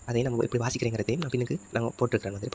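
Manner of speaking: ordinary talk, nothing marked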